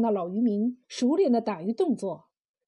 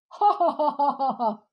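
hearty laugh. A narrator's reading. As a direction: laugh till you double over. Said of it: "老渔民笑的是前仰后合".

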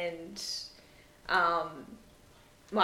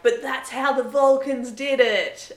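mock angry retort; 6 semitones higher, 9 dB louder than usual. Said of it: "really, like, loud and angry".

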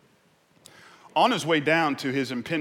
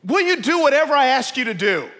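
loud, raised call from above; booming voice from heaven; 14 semitones higher, 11 dB louder than usual. Acted. Voice from heaven questions the man, calling out. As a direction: play God testing a man, loudly. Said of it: "the voice from heaven says".

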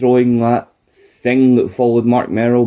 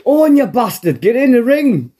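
hammed-up Bulldog yell; 12 semitones higher than usual. Co-host mimics Bulldog yelling at Owen, a much higher hammed-up shout. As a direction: bellow at Owen in a hammed-up high voice.

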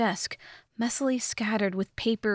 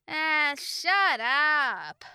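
higher, long-stretched groggy yell; irritated drawn-out shout; 6 semitones higher, much slower than usual. A narrator's reading. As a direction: holler it slowly, dragging out each word. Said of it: "shouted at the pale giant".

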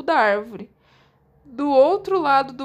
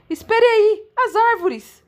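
higher and louder, struck by an idea; sudden realization; 8 semitones higher, 7 dB louder, slower than usual. Acted. Connor realizing the trees are the way, sudden excitement. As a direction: snap to the sudden thought.

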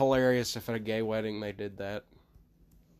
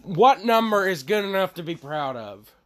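raised, higher voice; yelling; 6 semitones higher, 7 dB louder than usual. Real, not acted. Pressing for a number to be proud of, yelling. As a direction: belt it out far too loud. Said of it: "Why do you yell?".

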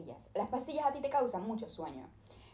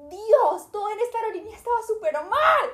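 much higher and louder rant; exasperated outburst; 12 semitones higher, 9 dB louder than usual. Real, not acted. Complaining to viewers about the airline, loud exasperated. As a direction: vent it at the top of your voice.

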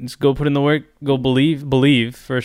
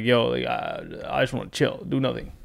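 unmotivated; 8 dB quieter than usual. Acted. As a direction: trail off, can't be bothered. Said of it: "I don't really feel like doing it".

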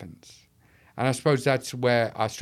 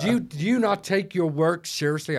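higher-pitched mock American accent; fake American accent; 6 semitones higher than usual. Repeating a doubter's challenge, fake American accent. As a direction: play the doubting American questioner. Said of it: "I'm doing a fake American accent".

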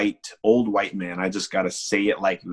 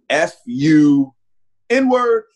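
louder, much higher retold insult; retold yell; 7 semitones higher, 7 dB louder, much slower than usual. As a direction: hurl the insult as it was yelled from the car. Man yells insult from car.

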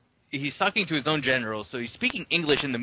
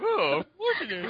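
much higher goofy mock voice; mock Sandler voice. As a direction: squeal it like a silly Sandler character. Repeating the Sandler voice joke.

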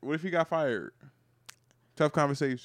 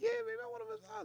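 soft, very high falsetto; mock girlfriend; 15 dB quieter than usual. Girlfriend accepting boyfriend's pampering, soft mock falsetto. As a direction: put on a quiet, cooing woman's voice. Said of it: "She's like".

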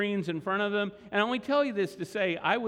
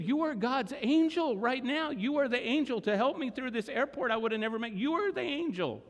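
put-on grateful old woman; 7 semitones higher than usual. Grieving widow thanks the helper.

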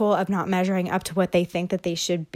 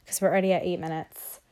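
ordinary delivery

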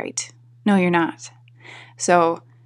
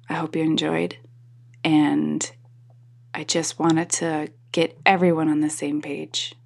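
ordinary talk, nothing marked

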